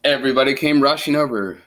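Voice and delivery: lively read-aloud storytelling voice; story narration. A narrator's reading. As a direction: read it like a children's book. Reads picture book aloud.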